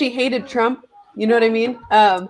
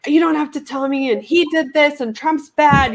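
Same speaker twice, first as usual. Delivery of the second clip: retold argument, pitched up; mock yelling; 6 semitones higher than usual. Mom cutting off her daughter in a political argument, mock yelling. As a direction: imitate a worked-up yell without the volume. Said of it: "basically it was just yelling".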